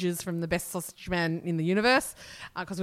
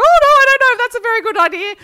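much higher, louder, fast mock of a tipsy mum; shrill tipsy mum; 13 dB louder, faster than usual. Daughter imitating her tipsy mum's objection, high and loud. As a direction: squeal it out like her startled mother.

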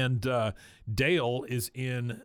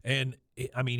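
ordinary talk, nothing marked

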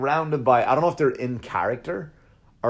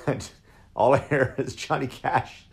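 ordinary talk, nothing marked

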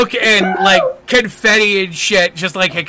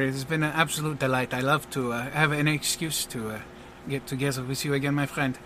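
soft, gracious showman with a hint of French; understated French-accented courtesy; lightly voiced French magician; 2 semitones lower, 9 dB quieter than usual. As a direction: greet an old friend suavely and quietly. Plays the French magician greeting a friend.

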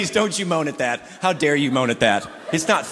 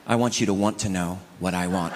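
low, quiet riddle; 10 semitones lower, 5 dB quieter than usual. Retold or imitated.

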